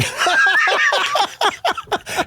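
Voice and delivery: squealing laugh; real laugh; high burst of laughter. Real, not acted. Laughs at story.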